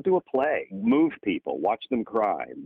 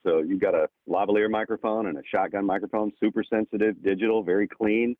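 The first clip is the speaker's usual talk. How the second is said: ordinary talk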